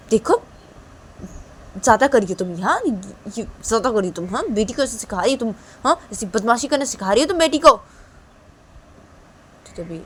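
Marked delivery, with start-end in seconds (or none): 0.1-7.7 higher, louder, fast reproach; scolding. Acted. Mother scolds Piyu for teaching daughter mischief, angry.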